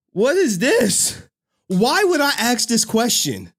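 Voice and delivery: high, slow, stunned question; can't believe what he's seeing; shocked disbelief. Real, not acted. Reacting in disbelief to clip.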